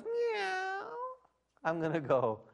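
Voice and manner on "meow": high-pitched meow from a dog; imitated cat meow. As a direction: mimic a cat's long, high meow. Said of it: "And if she goes".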